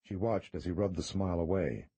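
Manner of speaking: usual speaking voice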